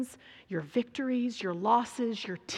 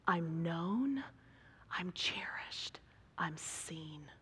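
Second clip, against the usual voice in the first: soft, slowing, sinking almost to a whisper; quiet, intimate realisation; hushed wonder; 3 semitones lower, 7 dB quieter, slower than usual. Retold or imitated. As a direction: let each phrase land gently, with pauses between. Hagar voiced in quiet wonder.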